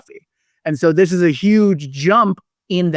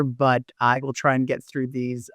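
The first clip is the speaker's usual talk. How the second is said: normal talking voice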